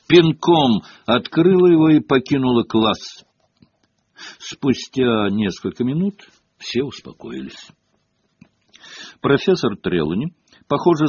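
usual speaking voice

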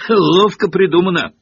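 derisive, punchy retort; scornful snort. A narrator's reading. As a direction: scoff at an obvious trick. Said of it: "фыркнул Рон".

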